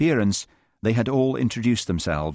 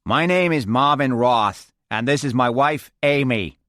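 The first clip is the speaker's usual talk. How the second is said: higher, voiced New York American; New York American accent; 4 semitones higher, 4 dB louder than usual. Marvin introducing wife to the group, New York American accent.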